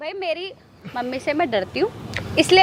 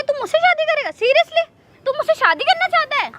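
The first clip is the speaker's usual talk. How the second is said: mocking disbelief; 10 semitones higher than usual. Mocking his wish to marry.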